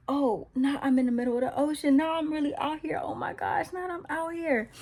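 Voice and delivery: retold fright, pitch rising without shouting; afraid. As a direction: act out growing alarm at being stranded. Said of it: "it says he's afraid".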